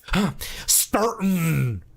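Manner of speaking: frustrated huff, then 'Sturton' very slow with overdone R and N; mock-drawl 'Sturton', dragging every sound; mom's exaggerated English. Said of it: "she'd get frustrated and she'd make a joke".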